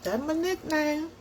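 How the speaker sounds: high-pitched, giggly delight; playful cutesy voice. Pretend. Storyteller claiming the nickname Sugar, playful high voice. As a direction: coo it high and proudly, in play.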